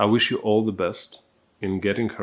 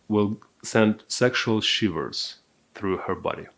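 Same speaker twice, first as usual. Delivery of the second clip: usual speaking voice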